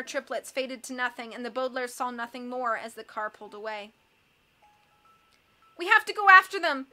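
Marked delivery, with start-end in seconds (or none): 5.8-6.9 high, loud, urgent cry; desperate raised plea; tearful scream. A narrator's reading. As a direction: scream for a pursuit. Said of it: "Violet screamed".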